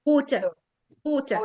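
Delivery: water with a weak final schwa; schwa ending. Shown as a demonstration. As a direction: let water end on a light uh. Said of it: "اللي هي شوا".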